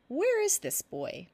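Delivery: alarmed. Acted. Janook alarmed about brother.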